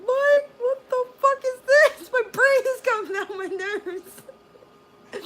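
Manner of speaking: shrieking high and loud, half crying, half laughing; panicked wail. Retold or imitated. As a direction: wail like your brain is falling out. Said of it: "started bawling".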